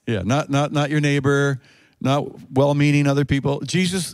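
normal talking voice

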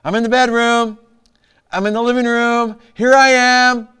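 high, loud call from another room; wife calling back. Acted. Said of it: "I'm listening for her response".

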